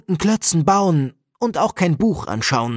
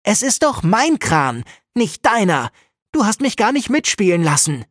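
put-on angry child; 6 semitones higher than usual. Angry boy argues with sister.

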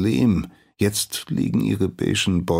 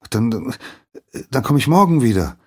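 put-on flustered boy; 6 semitones higher, 6 dB louder, faster than usual. A narrator's reading. Boy deflated, hurries off.

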